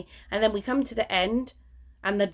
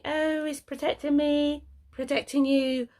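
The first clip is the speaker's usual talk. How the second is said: a hint of mocking people, raised pitch; mocking quote; 8 semitones higher than usual. Vlogger mimicking mask defenders, mildly mocking. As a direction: parrot them mockingly. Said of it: "what people say".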